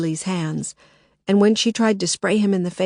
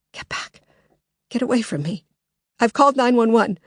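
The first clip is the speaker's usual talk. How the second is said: frightened; 4 semitones higher than usual. Acted. Lily backing away.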